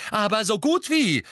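high, loud, fierce retort; vehement. A narrator's reading. Mrs Weasley retorting to Sirius about Harry, vehemently. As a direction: fire back vehemently. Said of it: "sagte Misses Weasley heftig".

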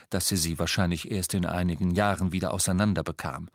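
plain reading voice

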